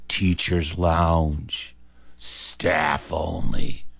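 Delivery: very slow, low, drawn-out words; ominous sign reading. Retold or imitated. Children read the lounge door sign, slow and ominous. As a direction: read it out like a forbidden threshold.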